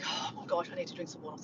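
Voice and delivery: imitating someone out of breath; soft, voiceless puffed-out imitation; winded hiker. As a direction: act worn out from the climb. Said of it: "get your breath".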